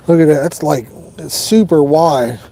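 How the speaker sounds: usual speaking voice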